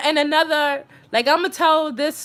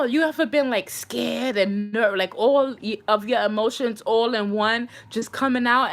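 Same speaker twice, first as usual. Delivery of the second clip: usual speaking voice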